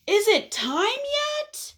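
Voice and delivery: high, drawn-out whiny question; whining impatient caterpillar. Acted. Caterpillar asks if it's time yet, whining. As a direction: whine it up high like an impatient kid. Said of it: "he does a lot of whining".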